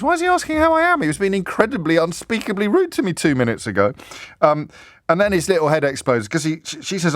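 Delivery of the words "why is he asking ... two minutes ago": baffled. Retold or imitated. Host imagines Wendy's confusion.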